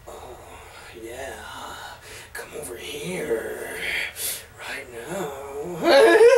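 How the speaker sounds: low, very soft seductive murmur; slow mock-seductive bedroom voice; sexy [name] voice. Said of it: "I did my sexy [name] voice".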